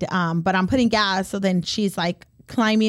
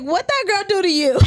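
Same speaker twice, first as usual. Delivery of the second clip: high, laughing outrage; hammed-up demand, pitched way up; mock indignation; 11 semitones higher, 4 dB louder than usual. Aunt asks child about mean tia, mock indignation.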